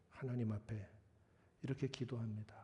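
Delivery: ordinary talk, nothing marked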